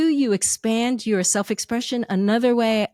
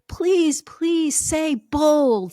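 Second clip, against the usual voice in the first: higher, drawn-out plea; pleading; 6 semitones higher, much slower than usual. Retold or imitated. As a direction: beg slowly, stretching each word. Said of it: "all of a sudden I had the word".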